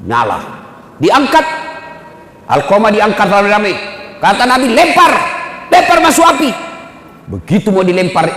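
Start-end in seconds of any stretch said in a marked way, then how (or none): 4.7-6.5 higher, louder stern order; commanding order. Acted. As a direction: order them to throw him in. Prophet orders him thrown in.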